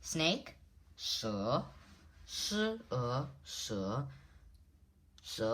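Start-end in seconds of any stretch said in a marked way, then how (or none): 1.0-3.8 "she" with pitch lifting at the end; rising second tone. Shown as a demonstration. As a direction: say "she" as an upward glide, split once into "shi, e". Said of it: "is the rising tone".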